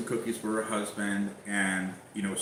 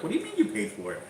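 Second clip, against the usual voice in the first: retold disbelief; 8 semitones higher, faster than usual. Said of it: "I was like".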